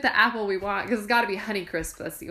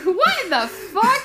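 higher, slow and laughing; mock outraged disbelief; 10 semitones higher, 5 dB louder, slower than usual. Offered a Pink Lady apple, higher and slowly outraged. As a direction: drag out the outrage. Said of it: "I'd be like".

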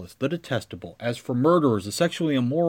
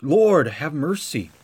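slow, drawn-out plea for mercy; preacher showing the congregation how to cry out; prayerful cry; 4 semitones higher, 3 dB louder, slower than usual. Shown as a demonstration. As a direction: pray it slowly, pleading. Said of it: "So cry out".

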